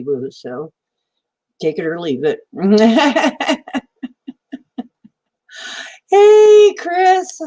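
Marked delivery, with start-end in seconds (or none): 6.1-7.4 loud excited greeting. Real, not acted. Greeting a viewer in chat.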